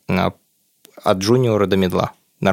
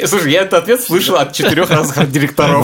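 teasing; 6 semitones higher, 4 dB louder than usual. Real, not acted. Teasing, raised voice.

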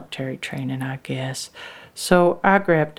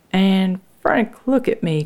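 ordinary delivery

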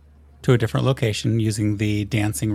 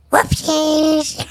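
pixie; 4 dB louder than usual. Acted. The rifle's little voice again.

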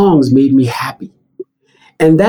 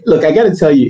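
usual speaking voice

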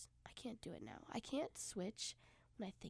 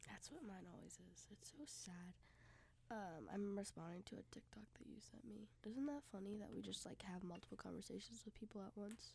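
soft unvoiced whisper; 13 dB quieter than usual. Real, not acted. Quiet chat beside a friend.

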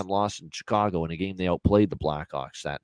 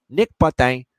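name punched in a mock French accent, higher and louder; 4 semitones higher, 6 dB louder than usual. Shown as a demonstration. Host showing off a player's name to viewers, mock French accent. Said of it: "as I like to say it with the French accent".

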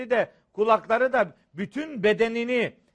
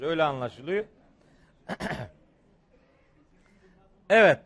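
ordinary delivery

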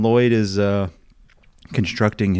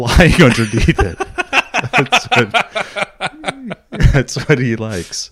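laughing; 8 semitones higher, slower than usual. Real, not acted. Laughing while describing.

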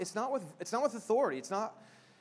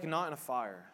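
usual speaking voice

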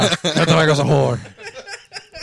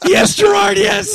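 mock swooning yell; much slower than usual. Fan swooning at Gerard Butler reading Goodnight Moon, high ecstatic yell. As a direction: squeal yes as a swooning fan.

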